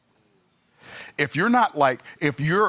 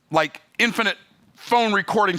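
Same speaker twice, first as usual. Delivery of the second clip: ordinary talk, nothing marked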